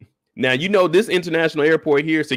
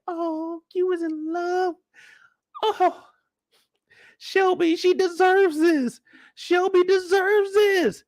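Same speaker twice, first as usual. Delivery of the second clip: much higher, slow swooning voice; mock gushing girls; much slower than usual. Girls filming the proposal, high slow swooning mock. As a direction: coo like starstruck fans. Mocking girls recording on phones.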